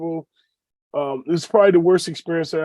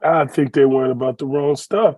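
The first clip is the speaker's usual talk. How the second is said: normal talking voice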